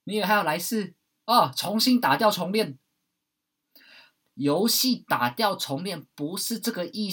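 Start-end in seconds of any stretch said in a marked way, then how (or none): 0.1-2.8 sarcastic, higher and louder; mocking. Mocking those who think death resets life, sarcastic. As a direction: scoff at the idea of a do-over. Mocking others' view of rebirth.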